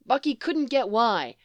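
ordinary delivery, nothing marked

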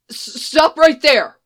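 high, loud, slow and stammering; a scared tech forcing out a warning; panicked scientist stammering stop at armed troopers; frightened stammer. A narrator's reading. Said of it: "one of them says".

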